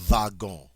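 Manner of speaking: wagon said vagon, the W as a V, nasal final on; French wagon, V sound, nasal ending. Shown as a demonstration. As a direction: say wagon like vah-gon, V up front. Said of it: "is actually pronounced".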